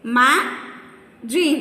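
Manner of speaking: "Imagine" slowly in three beats, I, ma, gine; slow, broken-up "Imagine" for counting; syllable by syllable. Shown as a demonstration. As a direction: pause between the syllables of "Imagine". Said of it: "Three syllables".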